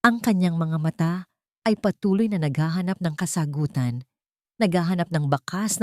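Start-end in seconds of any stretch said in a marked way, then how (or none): none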